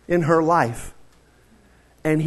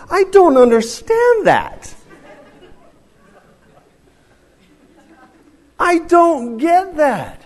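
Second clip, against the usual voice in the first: high, slow, exasperated disbelief; 12 semitones higher, 6 dB louder, slower than usual. Real, not acted. Preacher baffled by saggy pants, high and exasperated. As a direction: stretch it out high like you truly can't fathom it.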